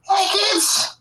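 extremely high raspy squeak; high-pitched and raspy. Shown as a demonstration. Co-host showing Mickey Mouse who smoked, high and raspy. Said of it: "high pitched and raspy".

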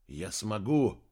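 ordinary delivery, nothing marked

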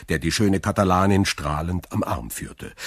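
usual speaking voice

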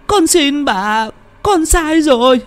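high wailing plea, rising to a cry; pained begging. A narrator's reading. Beaten woman begging ancestors and husband, high wailing. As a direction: wail and beg through the pain.

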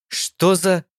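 shocked. A narrator's reading. Just slapped in face.

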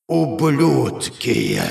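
slow, dragged-out monstrous snarl; a demon spitting the insult, grinding and echoing; demigod's grinding voice. A narrator's reading. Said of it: "Голос полубога походил ... эхо сотни голосов".

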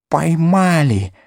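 high, slow gurgling hiss; throaty hiss. A narrator's reading. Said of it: "прошипел горлом". (горловое шипение, высоко и медленно)

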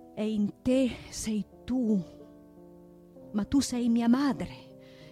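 usual speaking voice

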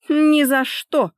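high, loud, drawn-out defiance; snorting refusal. A narrator's reading. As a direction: refuse with an indignant huff. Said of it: "фыркнула и попыталась уйти". (фыркнув, громко и упрямо)

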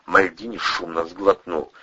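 ordinary delivery

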